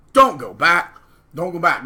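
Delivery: sigh, then a loud, slow "don't go back"; exasperated plea. Real, not acted. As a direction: beg them not to return.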